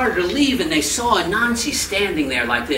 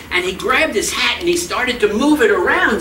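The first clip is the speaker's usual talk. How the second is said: ordinary delivery, nothing marked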